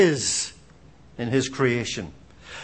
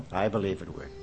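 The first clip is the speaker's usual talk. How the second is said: much softer, lower repeat; quiet echo; 11 dB quieter, faster than usual. Shown as a demonstration. Preacher repeating his verdict to himself, hushed. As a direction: let it fall away to yourself. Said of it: "repeated conviction".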